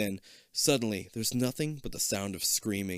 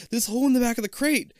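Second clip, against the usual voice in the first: put-on excited kid; 11 semitones higher, 6 dB louder than usual. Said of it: "Kip exclaims".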